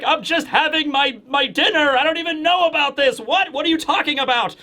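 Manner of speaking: high-pitched, acted baffled protest; bewildered innocent. Diner to officers raiding the house, mock bewilderment. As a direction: play a flustered diner caught off guard. Startled diner answers the raiders.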